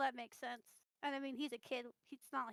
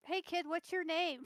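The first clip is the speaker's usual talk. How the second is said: tag; 5 semitones higher, 8 dB louder than usual. Acted. Player calls to the kid in character.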